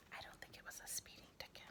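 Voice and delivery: fast whispered aside. Real, not acted. Teacher whispering a joke aside to her class, fast whisper. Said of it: "aside to class".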